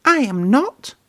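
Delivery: high indignant Percy; upset little engine protesting; protesting cry. A narrator's reading. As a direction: deny it shrilly. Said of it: "cried Percy".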